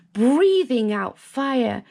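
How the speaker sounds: ordinary delivery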